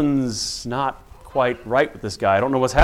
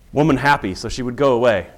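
ordinary talk

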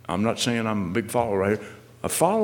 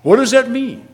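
loud, high preacher's questions; impassioned preaching; 7 semitones higher, 9 dB louder than usual. Real, not acted. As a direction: hammer each question at the congregation. Preacher presses the congregation.

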